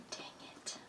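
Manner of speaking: breathy whispered complaint; hushed mock grumble. Recalled childhood complaint.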